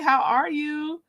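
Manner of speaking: ordinary delivery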